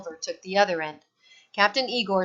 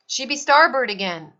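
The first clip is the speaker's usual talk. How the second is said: put-on loud, higher old sea captain shout; captain yelling to the crew; 5 semitones higher, 8 dB louder than usual. A narrator's reading. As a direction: bark the side like a skipper. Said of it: "Captain Igor yelled".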